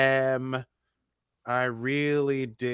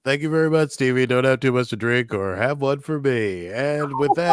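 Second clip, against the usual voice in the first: loud mock anchor handover; studio host; 2 semitones higher, 8 dB louder than usual. Host thanks Stevie at the wrap party, loud studio-anchor voice. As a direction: thank the roving reporter in a big host voice.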